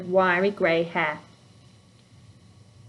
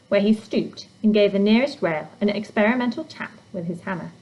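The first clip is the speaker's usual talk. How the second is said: usual speaking voice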